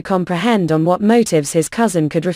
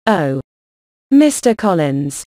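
a drawn-out, mildly doleful sigh of a greeting; 2 semitones lower, slower than usual. A narrator's reading. Mother opening a talk with the rejected suitor, mildly doleful. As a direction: lay on a touch of self-pity, slowly. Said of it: "In a doleful voice Missus Bennet began".

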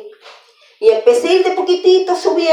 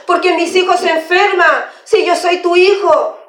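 complaining to God; 4 semitones higher than usual. Acted. Believer asking the Lord why their children suffer, complaining. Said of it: "reclamamos al Señor".

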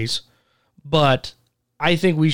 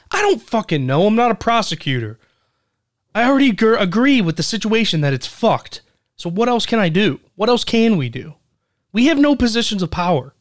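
raised, higher-pitched exasperation; exasperated; 8 semitones higher than usual. Real, not acted. Co-host answering people who blame him, exasperated. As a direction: protest that it's out of your hands.

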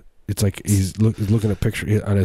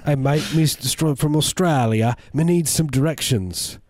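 higher, hammed-up Australian tourist; bad Australian accent; 7 semitones higher than usual. A tourist asking for directions, in a mock Australian accent. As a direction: play a clueless Aussie asking the way. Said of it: "I do this accent".

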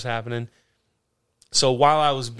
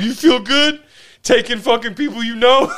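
loud, high mocking taunt; taunting the cop; 9 semitones higher, 6 dB louder than usual. Drunk arrestee taunting the young cop, loud and high. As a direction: needle him hard from the back seat. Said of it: "I was like".

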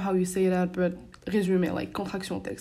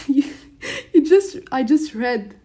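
laughing through the words, higher and louder; 6 semitones higher, 4 dB louder than usual. Real, not acted. Student recalling the baffling exam question, laughing. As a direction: restart the sentence through real laughter.

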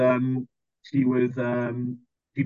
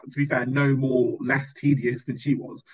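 ordinary delivery

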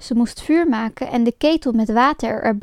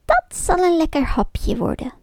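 greedy witch voice, a bit higher; greedy witch eyeing the sleeping children; muttering to herself; 5 semitones higher than usual. A narrator's reading. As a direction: savour it like a hungry witch. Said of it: "mompelde ze in zichzelf".

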